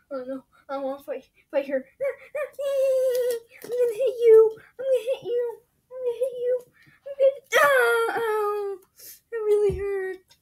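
high, whiny fighter; squeaky cartoon voice. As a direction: squeal and whimper through the fight.

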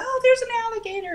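high, fast panicked announcement; high-pitched excited alert; alarmed exclamation. Retold or imitated. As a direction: blurt it out in a retold panic. Acting out alarm at the neighbor's door.

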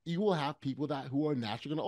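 usual speaking voice

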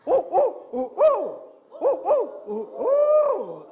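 loud, high hoots with a long falling last note; barred owl hoot. Shown as a demonstration. Handler demonstrating the barred owl's crazy call, loud high hooting. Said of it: "On this side".

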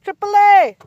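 high, loud hail; calling out. Real, not acted. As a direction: shout to get the driver's attention. Calling out to the tow driver.

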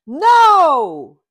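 loud, high shout of No!. A narrator's reading. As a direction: yell it with everything. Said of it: "shouts Kitty".

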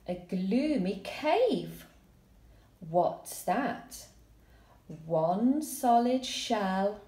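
slow, dramatic read-aloud building to a high excited cry; expressive story reading. Shown as a demonstration. Teacher reading the class story, slow then urgent. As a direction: read it like a spooky little adventure.

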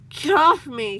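high, loud struggling yell; crying out. A narrator's reading. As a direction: shout it while fighting to get free. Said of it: "the cat cried as he struggled".

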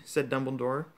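plain reading voice

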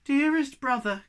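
high, loud appeal; crying out. Acted. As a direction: call out imploringly. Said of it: "cried the Snork Maiden".

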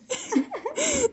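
high, soft giggling fit; laughing. Real, not acted. Artist amused by her hamster-like bear, giggling. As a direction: giggle helplessly at your own mess. Laughs at own terrible drawing.

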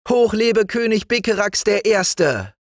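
very high, loud cheer; the old frog queen hailing the king; queen calling loudly. Acted. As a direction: cheer the new king at full voice. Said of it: "die laut rief".

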